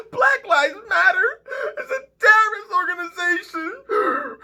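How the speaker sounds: high, mocking impression of the talk; mocking pundit voice. Poet mocking critics who call the movement terrorist, high mocking voice. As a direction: sneer it like a pundit quote. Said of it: "I know you heard the talk".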